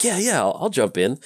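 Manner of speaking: high-pitched, eager retold reaction; eager joining in. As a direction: act out jumping on the bandwagon. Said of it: "you're like".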